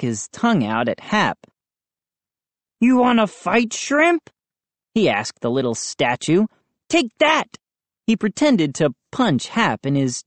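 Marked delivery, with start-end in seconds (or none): 2.8-4.3 taunting bully. A narrator's reading. Moose taunts the statue. 6.9-7.6 put-on bully kid voice. A narrator's reading. Moose fake punch.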